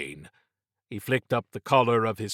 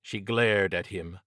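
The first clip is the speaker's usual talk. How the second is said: plain reading voice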